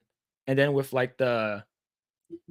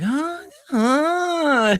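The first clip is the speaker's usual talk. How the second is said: mock Korean exclamation; 12 semitones higher, 4 dB louder than usual. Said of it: "Korean is like".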